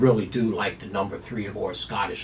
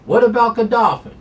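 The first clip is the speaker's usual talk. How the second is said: excited; 11 semitones higher, 8 dB louder than usual. Real, not acted. Hyped rhetorical call.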